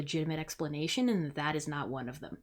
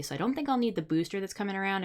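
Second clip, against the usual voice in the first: ordinary delivery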